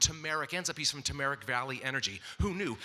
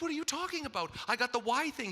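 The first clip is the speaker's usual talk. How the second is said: incredulous protest; 8 semitones higher than usual. Retold or imitated. Surprised by wife's plan.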